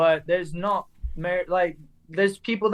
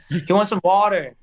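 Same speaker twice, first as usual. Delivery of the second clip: louder American "water" with a clear r; American-accented translation; 3 semitones higher, 5 dB louder than usual. Retold or imitated. Grandchild translating granddad's order for the server, emphatic American accent.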